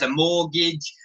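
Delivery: usual speaking voice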